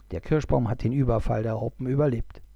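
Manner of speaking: ordinary delivery, nothing marked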